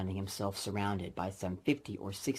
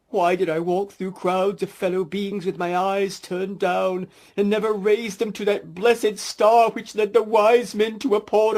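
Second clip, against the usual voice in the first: much higher, far louder anguished wailing ghost; ghost lament; 12 semitones higher, 14 dB louder than usual. Acted. Marley's ghost lamenting to Scrooge, loud anguished wail. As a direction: wail it out in despair.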